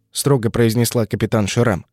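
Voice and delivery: ordinary delivery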